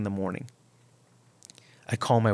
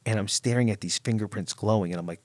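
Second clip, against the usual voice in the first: ordinary talk, nothing marked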